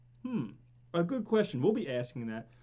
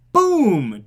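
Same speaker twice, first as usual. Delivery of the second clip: loud boom; 2 semitones higher, 12 dB louder than usual. Real, not acted. Celebrating the bet win.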